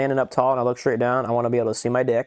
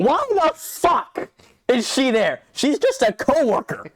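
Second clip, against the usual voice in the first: high, incredulous ranting; worked-up, high-pitched disbelief; indignant outburst; 11 semitones higher, slower than usual. Real, not acted. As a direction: blow up in exasperated disbelief, pitch way up. Indignant rant about show's plot.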